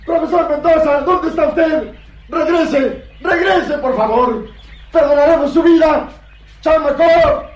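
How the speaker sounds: desperate, high-pitched yelling; gritos de loco. Acted. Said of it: "Rosco empezaba a gritar como loco". (gritando desesperado como un loco)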